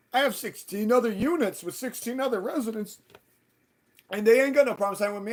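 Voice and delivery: high, loud imitation of a boast; indignant, high-pitched mock argument; mocking landlord. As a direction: mimic him protesting, pitched well up. Mocks landlord boasting in retold quarrel.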